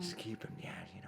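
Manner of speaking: hushed conspiratorial whisper. Retold or imitated. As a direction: whisper it behind a hand. Whispering the Fox bit.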